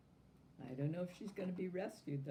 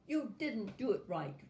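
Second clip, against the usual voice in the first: high, loud complaint; fault-finding little tyrant; scolding doll; 6 semitones higher, 4 dB louder than usual. A narrator's reading. Doll scolding Natasha about the wrinkled dress.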